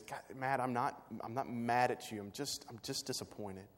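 soft, hushed voice, dropping at the end; understated, quiet disappointment; gentle disappointment. Retold or imitated. God's gentle response, imagined.

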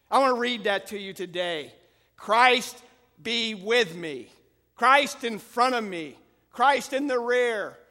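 loud, slow, ringing proclamation; loud proclaiming preacher. Acted. Preacher reading an old prayer aloud to the church, slow ringing proclamation. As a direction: call each line out to the church.

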